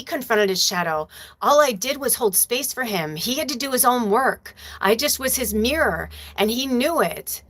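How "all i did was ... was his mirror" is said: usual speaking voice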